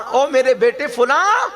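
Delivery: mother calling her absent son. Acted. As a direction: call across the house for your son.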